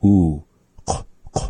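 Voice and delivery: "Oo", then voiceless rounded "k, k"; rounded lips. Shown as a demonstration. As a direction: shape "k" with the mouth set for "Oo". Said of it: "like taking that oo vowel".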